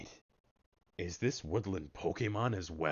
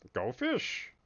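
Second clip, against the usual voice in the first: a high, mocking sing-song go fish; brutish fisherman taunt. Gamer voicing the fisherman mid-fight, high mocking taunt. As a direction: tease like the big fisherman. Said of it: "something brutish".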